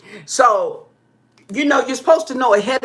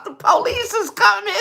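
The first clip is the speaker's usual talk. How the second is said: laughing mock warning; 10 semitones higher than usual. Retold warning to runners.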